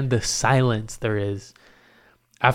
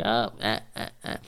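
halting, stuttered little noises; soft awkward stammer of mock surprise; awkward fake surprise; 8 dB quieter than usual. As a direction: act awkwardly caught off guard. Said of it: "I'm like super surprised, like I'm awkward".

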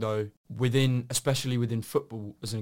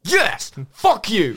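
triumphant shout; 9 semitones higher, 10 dB louder, much slower than usual. Real, not acted. Celebrates guessing polo right.

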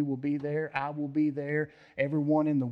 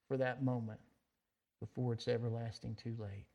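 closing prayer; 3 dB quieter than usual. Acted. Pastor praying for the congregation at the sermon's end, soft and reverent. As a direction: pray quietly over the congregation.

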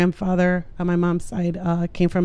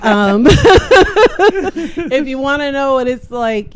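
laughing; 6 semitones higher, 6 dB louder, much slower than usual. Real, not acted. Laughs while telling family story.

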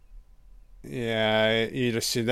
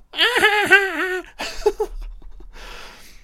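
high rapid cackle; long high-pitched giggle fit; helpless laughter. Real, not acted. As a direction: burst out laughing, then trail away. Laughing at the crazy host.

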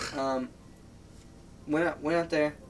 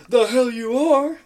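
high, loud, slow sarcastic retort; ex's retort; 10 semitones higher, 11 dB louder, slower than usual. Retold or imitated. Ex answering his sleepy proposal, loud scoffing retort. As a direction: drag it out, scoffing.

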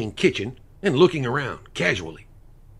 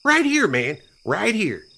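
smug; 11 semitones higher, 6 dB louder, slower than usual. Acted. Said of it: "spread his hands out and smiled".

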